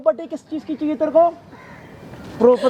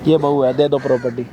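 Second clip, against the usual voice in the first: mock crying; 12 semitones lower than usual. Mocking the crying daughter-in-law.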